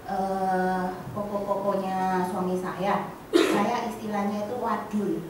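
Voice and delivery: normal talking voice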